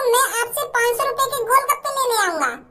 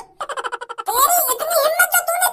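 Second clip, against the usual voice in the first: furious nephew; 6 semitones higher than usual. Acted. Nephew furious at cart smashed.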